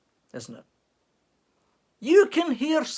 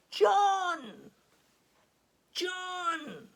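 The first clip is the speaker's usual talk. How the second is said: distant Scottish shout of a name; calling from afar; 13 semitones higher than usual. Acted. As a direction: call his name out over the hills. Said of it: "complete with the accent".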